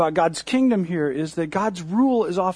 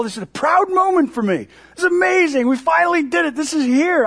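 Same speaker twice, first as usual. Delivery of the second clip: high, loud and thrilled; proud excitement; 11 semitones higher, 5 dB louder than usual. Retold or imitated. A reveler at the parade, proudly excited. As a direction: gush with pride at the moment. Voicing the crowd's pride.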